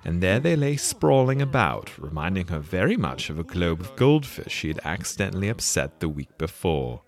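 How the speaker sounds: ordinary delivery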